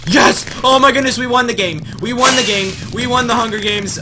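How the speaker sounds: high shrieking victory yell; shrieking jackpot winner. Real, not acted. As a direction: scream it like you just won everything. Wins the match, screams.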